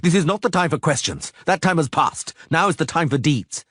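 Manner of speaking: high, pressing sorcerer's demand; Istredd, agitated. Acted. Istredd refusing Geralt's questions, high agitated voice. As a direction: cut off the questions. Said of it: "narrator voicing Istredd".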